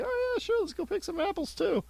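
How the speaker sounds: very high mock-eager voice. Cohost retelling his fake excitement at rotten apples. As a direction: squeak it with fake enthusiasm. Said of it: "I was like".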